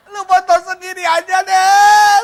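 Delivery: very high, loud comic outburst; exasperated shout. Retold or imitated. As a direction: yell it in mock frustration, stretching the end. Husband snaps after photo criticism.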